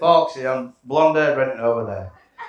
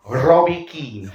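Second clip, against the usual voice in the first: slow, drawn-out naming of a footballer; a weird shopkeeper sizing John up, slowly; shopkeeper's verdict; 6 dB louder, much slower than usual. Retold or imitated. Shopkeeper eyes John at the till, slow drawn-out verdict.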